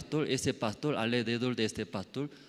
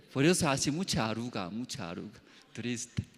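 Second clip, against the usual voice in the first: ordinary talk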